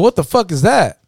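high-pitched baffled question, WHAT leading. Retold or imitated. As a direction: act out a friend asking what on earth. Baffled friend's first acted question.

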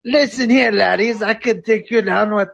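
terrible mock Scottish accent; trash-talk in a badly faked Scottish accent; Conor McGregor. Dad threatens the laddies as a fighter, bad Scottish accent. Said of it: "your Scottish accent sounds terrible".

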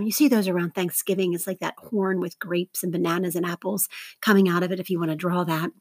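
normal talking voice

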